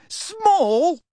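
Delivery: very high, rising squeak of disbelief; shrill, astonished question; incredulous. A narrator's reading. Hermione repeats it in disbelief.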